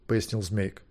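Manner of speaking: ordinary delivery, nothing marked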